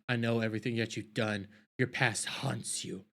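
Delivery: low, soft threatening murmur, imitating May; hushed menace. May quietly threatening the old Jedi master, hushed menace.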